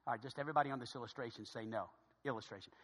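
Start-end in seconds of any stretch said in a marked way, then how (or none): none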